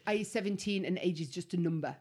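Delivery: low, badly imitated London accent; rubbish London accent. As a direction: do a shaky attempt at a London lad. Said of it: "and this is how he spoke".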